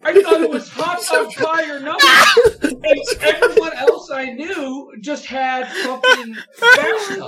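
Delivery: high, loud laughing-crying rant; exasperated laughing rant. Real, not acted. Ranting to friends about the bathtub, loud laughing wail.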